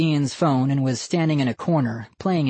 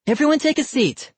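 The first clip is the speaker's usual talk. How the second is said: much higher announcing voice; hostess announcing; 13 semitones higher than usual. A narrator's reading. Missus Barker seats the audience, high announcing voice. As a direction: settle the audience down. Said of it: "Missus Barker said".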